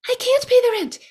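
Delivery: very high pleading damsel voice; damsel in distress. Pretend. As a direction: squeal it like a helpless victim. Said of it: "this is the world's shortest play".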